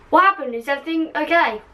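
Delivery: hurried, urgent asking; said fast. Acted. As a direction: blurt both questions out quickly. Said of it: "Harry said fastly".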